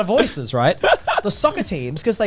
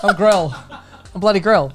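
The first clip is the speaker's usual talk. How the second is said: proud and laughing, a bit louder; laughing pride; 5 semitones higher, 4 dB louder than usual. Real, not acted. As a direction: announce it with a laugh. Proudly claims anime role.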